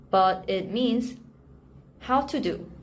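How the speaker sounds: normal talking voice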